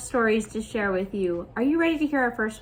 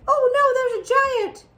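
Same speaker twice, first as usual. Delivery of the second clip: put-on high, loud little-boy puppet voice; teacher voicing the paper Jack, high and excited; playful Jack voice; 12 semitones higher, 5 dB louder than usual. Puppet Jack meets the giant.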